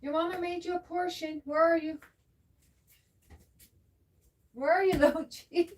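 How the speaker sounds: high, soft cat-calling voice; coaxing a pet. Real, not acted. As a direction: coo to a little cat to come and eat.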